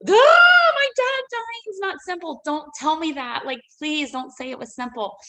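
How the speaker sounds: a high, loud cry of pain that softens to a plea; hurt outburst. Retold or imitated. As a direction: burst out hurt, then beg him to stop. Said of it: "it really angered me".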